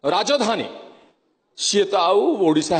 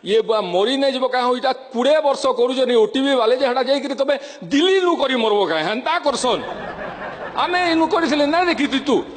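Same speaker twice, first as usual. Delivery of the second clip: higher, lively Sambalpuri speech; Western Odia dialect; 3 semitones higher than usual. Retold or imitated. Mocking the OTV crowd, in Western Odia. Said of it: "western Odisha ଭାଷାର".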